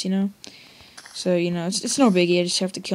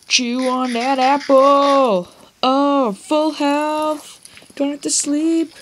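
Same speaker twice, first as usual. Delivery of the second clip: higher, louder happy crowing; excited; 6 semitones higher, 6 dB louder, much slower than usual. Real, not acted. Gamer thrilled at full health, excited higher louder. Excited eating apple in game.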